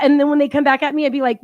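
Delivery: ordinary talk, nothing marked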